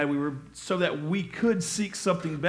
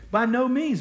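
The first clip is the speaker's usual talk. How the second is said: By no means rung out much higher and louder; emphatic reading; 8 semitones higher, 7 dB louder than usual. Shown as a demonstration. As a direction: shout the Greek exclamation point. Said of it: "There's an exclamation point there".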